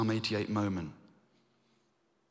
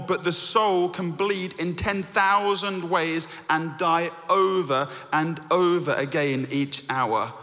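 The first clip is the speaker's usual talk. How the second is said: higher, louder, slowed for weight; impassioned reading; 5 semitones higher, 4 dB louder than usual. Shown as a demonstration. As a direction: read it slowly with rising passion. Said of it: "Listen carefully".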